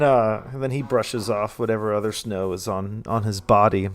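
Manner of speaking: usual speaking voice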